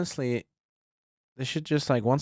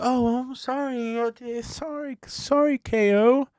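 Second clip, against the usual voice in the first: high, slow fake-sorry whine; mock apology; 11 semitones higher, slower than usual. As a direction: overdo the innocent apology. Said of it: "And Cody's like".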